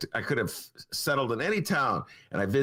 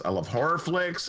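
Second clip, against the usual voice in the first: ordinary talk, nothing marked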